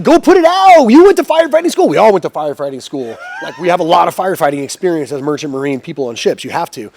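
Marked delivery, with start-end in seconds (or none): 0.0-1.9 high, loud panicked shouting; frantic yelled orders. Retold or imitated. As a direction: yell desperately down the phone. Retold frantic orders during fire.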